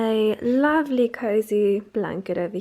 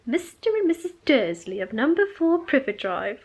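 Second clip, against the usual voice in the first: higher-pitched put-on British reading voice; British accent; 4 semitones higher, 4 dB louder than usual. Reader voicing the book's opening as it sounds in mind, British accent. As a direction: read it out in a playful British accent. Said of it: "read this in a British accent".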